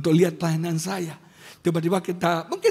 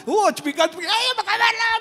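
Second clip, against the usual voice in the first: high, excitable mock greeting; bubbly girl impression; 12 semitones higher than usual. Pastor imitates his type of girl greeting, high and excitable. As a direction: squeal hello like a sociable girl.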